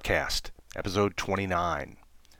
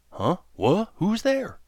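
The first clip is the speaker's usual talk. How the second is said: high, startled and louder; jolted awake, who's there; startled waking; 11 semitones higher, 5 dB louder, slower than usual. Acted. Turtle woken suddenly at night.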